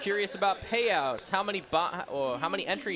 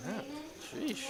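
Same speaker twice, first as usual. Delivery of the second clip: usual speaking voice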